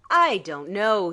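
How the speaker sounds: skeptical, emphatic "I don't know"; doubting emphasis. A narrator's reading. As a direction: lean on the doubt. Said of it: "he said with doubting emphasis".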